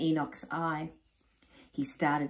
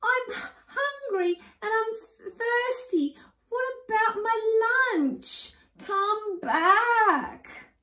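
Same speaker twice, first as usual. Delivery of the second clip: much higher, crying squeaky plea; whimpering plea; 5 dB louder, slower than usual. Acted. Abandoned husband crying for his wife to return. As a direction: wail after her like a child.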